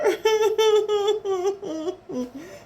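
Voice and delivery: high pretend boo-hooing, drawn out; hammed-up sobbing for little listeners; mock crying wail. A narrator's reading. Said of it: "cried Bird's friends together".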